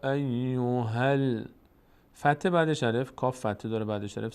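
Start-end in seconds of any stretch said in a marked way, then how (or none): 0.0-1.4 یا ایوهل stretched very slowly, هل joined to the lam; slow joined reading. Shown as a demonstration. Teacher joining the fatha to the lam, very slowly.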